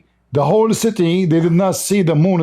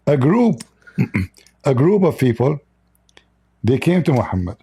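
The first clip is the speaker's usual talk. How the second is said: normal talking voice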